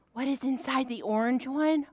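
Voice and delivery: usual speaking voice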